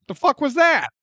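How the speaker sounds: high, loud "what the hell"; shocked and incredulous; baffled outburst. Acted. Reacting to the phone call.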